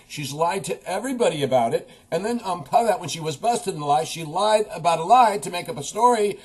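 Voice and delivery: loud, high, incredulous outburst; exasperated rant. Real, not acted. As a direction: build from accusation to heated disbelief. Exasperated rant at Clinton's believers.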